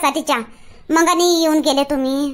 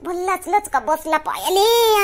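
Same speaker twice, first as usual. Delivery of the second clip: hammed-up groans of a sprained foot; mock pain moaning. Wife moaning to husband about her sprained foot, hammed-up. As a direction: moan over the twisted foot.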